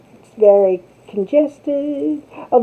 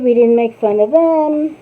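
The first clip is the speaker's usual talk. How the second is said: ordinary talk, nothing marked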